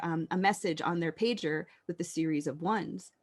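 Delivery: usual speaking voice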